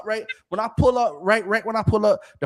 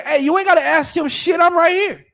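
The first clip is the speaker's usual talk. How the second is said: higher and louder call through the window; retold confrontation; 10 semitones higher, 7 dB louder than usual. Calling out to the wife at the window, loud and sharp. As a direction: call out hard to claim the fight. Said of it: "And I say".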